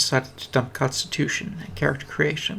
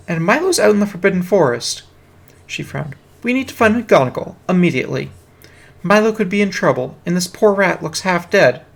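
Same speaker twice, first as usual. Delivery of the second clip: put-on higher, louder urgent girl voice; urgent schoolgirl; 5 semitones higher, 7 dB louder than usual. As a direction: press the others to act now. Girl urges finding McGonagall.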